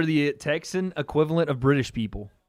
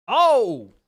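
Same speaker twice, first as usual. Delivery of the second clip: surprised shout; 6 semitones higher, 6 dB louder than usual. Real, not acted. Big punch lands.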